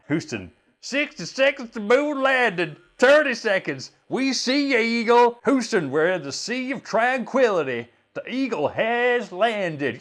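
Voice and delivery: high, loud rising radio excitement; rising excitement, high and loud, right up to the landing; excited mission control. Acted. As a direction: build to the landing cheer. Acted moon landing radio, building up.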